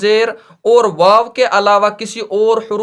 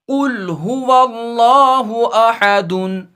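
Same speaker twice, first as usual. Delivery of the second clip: slow, drawn-out Quranic recitation; slower than usual. Shown as a demonstration. Teacher recites Surah Ikhlas to students in slow recitation. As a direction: chant the verse slowly like tilawat. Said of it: "यहाँ सूरतुल इखलास में लिखा है".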